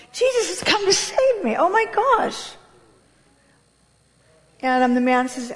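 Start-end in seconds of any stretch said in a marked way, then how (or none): 0.1-2.5 excited relief. Retold or imitated. Thinking she is saved.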